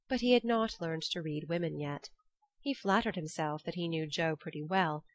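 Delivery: plain reading voice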